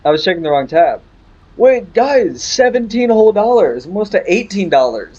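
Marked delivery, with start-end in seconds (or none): 1.6-4.7 thrilled announcement; excited. Real, not acted. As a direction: announce the money to chat in delight. Excited at earnings.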